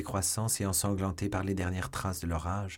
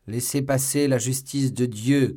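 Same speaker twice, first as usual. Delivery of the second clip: loud, raised proclamation; loud cry; 4 semitones higher, 7 dB louder than usual. A narrator's reading. Executioner over the river with the body, crying aloud. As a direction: proclaim it loudly for all to hear. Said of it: "cria-t-il à haute voix".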